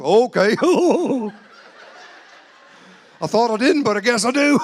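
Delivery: high, loud, laughing give-in; laughing surrender. Retold or imitated. Meekly giving in, laughing.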